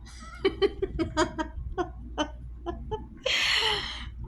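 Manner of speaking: high, helpless shriek of laughter; real high-pitched cackle. Real, not acted. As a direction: break down laughing. Bursts out after punchline.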